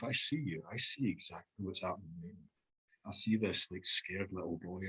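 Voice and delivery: soft, quiet seeing-through-you voice; hushed and steady, barely above a murmur; calm knowing. Retold or imitated. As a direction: say it gently, like you've seen right through him. Partner's calm knowing look voiced.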